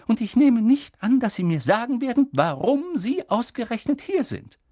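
stern witch pushing for answers; urgent McGonagall. A narrator's reading. McGonagall challenging.

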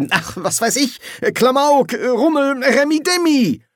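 high, excited burst of ideas; exasperated brainstorming. Acted. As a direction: toss out words with rising zest. Schnapper casting about excitedly for ideas.

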